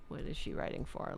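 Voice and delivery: muttered under breath to herself; whispered aside. Real, not acted. Streamer searching online, whispered to herself.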